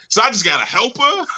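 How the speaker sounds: mock disappointed student. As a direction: play the letdown big.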